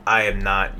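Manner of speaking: ordinary talk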